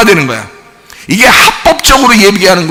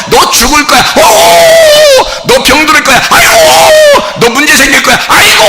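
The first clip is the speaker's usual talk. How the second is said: hammed-up frightened cries of aigo, very high; mock trembling wail; 13 semitones higher than usual. Pastor mocking fearful believers, hammed-up high wailing. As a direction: mimic a believer quaking at the threats. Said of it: "또 벌벌 떠네".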